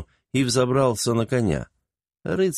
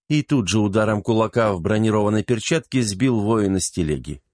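plain reading voice

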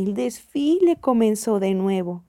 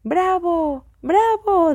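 high cheers, the second louder; crowd cheering; 8 semitones higher, 5 dB louder, slower than usual. A narrator's reading. All the people applaud Jesus, cheering. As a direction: cheer higher on each bravo. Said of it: "decía toda la gente".

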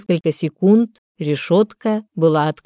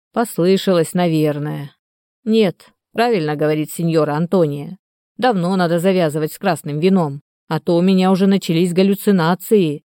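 usual speaking voice

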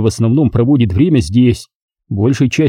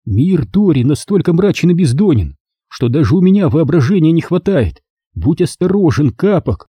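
put-on troubled older woman, higher pitch; 5 semitones higher than usual. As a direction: recall a puzzling loss gently. Leonora warns.

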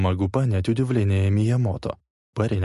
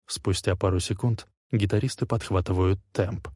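ordinary delivery, nothing marked

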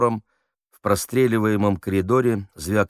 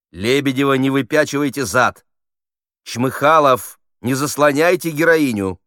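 loud, raised corrective calls; bossy rehearsal director, pitched up; director calling orders; 7 semitones higher, 7 dB louder than usual. Acted. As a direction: order the actors about from the stalls.

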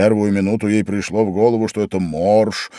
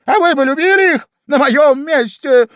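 piercing mouse squeal; 6 dB louder than usual. A narrator's reading. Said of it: "пронзительно пропищала мышь". (пронзительный мышиный писк)

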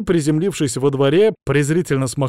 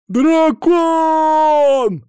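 very high, long, loud shriek; panicked squeal; 8 dB louder than usual. Acted. Militiamen seeing the dragon transform, panicked shriek. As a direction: scream the word out in terror. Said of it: "завизжали ополченцы".

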